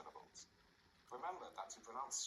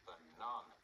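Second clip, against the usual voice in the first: "Banana" with NA in the middle stressed, lower-pitched overall; stress on second syllable; 4 semitones lower, 4 dB louder than usual. Shown as a demonstration. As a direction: hit the middle syllable of Banana, keep the outer ones weak. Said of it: "the stress is on the second syllable".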